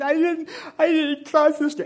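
high, loud, slow sobbing plea; ugly cry. Retold or imitated. Boy caught by babysitter, wailing a tearful denial. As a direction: wail it like a caught kid lying. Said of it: "It was an ugly cry".

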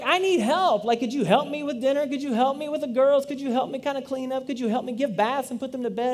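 exasperated wife. Retold or imitated. Wife pleading with her husband for help, exasperated and high. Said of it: "She's like".

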